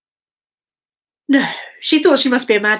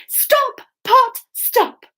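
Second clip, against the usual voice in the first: high chanted spell; magic words; 11 semitones higher, much slower than usual. Shown as a demonstration. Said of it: "After three".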